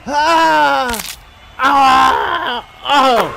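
high, loud, drawn-out wails; hammed-up howls of pain; loud wailing cry. Player cries out in game.

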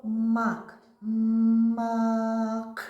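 «мак» said loud and slow, every sound distinct; word spoken clearly. Shown as a demonstration. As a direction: say «мак» loudly for the children to repeat. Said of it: "разом зі мною промовимо слово". («мак» голосно й чітко, кожен звук)

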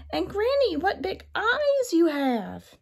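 put-on high wondering girl voice; little girl voice. A narrator's reading. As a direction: innocent surprised child. Girl addresses disguised wolf.

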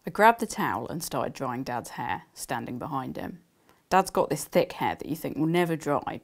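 reading her novel aloud to listeners; gentle storytelling read; story narration. Acted. Author reading her book to the audience, storytelling.

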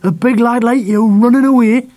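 high, loud disgusted reproach. A narrator's reading. Father shames big lad for running away, disgusted. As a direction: shame the boy with open disgust. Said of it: "his voice full of disgust".

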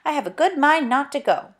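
usual speaking voice

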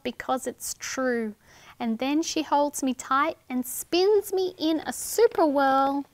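put-on lively sing-song reading to children; rhyme read aloud. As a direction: read the rhyme brightly to little ones. Reading a rhyming picture book.

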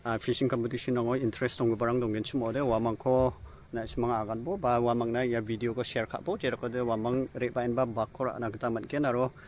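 normal talking voice

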